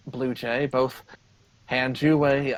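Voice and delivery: usual speaking voice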